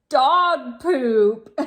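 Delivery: giggling through the words, slowed down; can't keep a straight face reading it; amused laugh breaking into the line. Real, not acted. Giggling at dog poop detail.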